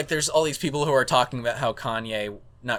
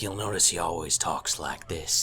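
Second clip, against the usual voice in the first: gravelly voice; 9 semitones lower, 7 dB quieter than usual. Retold or imitated. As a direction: rumble it low like a Texan actor. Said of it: "speak in a gravelly voice".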